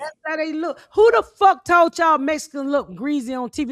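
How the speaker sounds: high, loud incredulous shout; mock indignant yell; mocking outrage. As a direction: throw their excuse back angrily.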